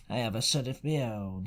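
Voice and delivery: fish for information with a sly pirate voice; sly seafaring stranger. Acted. Stranger's voice in story.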